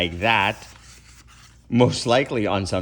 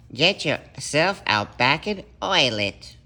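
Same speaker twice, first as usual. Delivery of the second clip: yapped; 5 semitones higher, slower than usual. Acted. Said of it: "he yapped".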